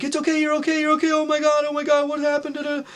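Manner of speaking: very high, frantic imitated mother; high-pitched mock fright over a rescued child; panicked mom. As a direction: gush reassurance in an acted panic. Panicked mom pulls child from pool.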